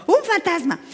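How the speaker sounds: high mock frightened cry; mock fright. Preacher imitating disciples seeing a ghost, high mock scare.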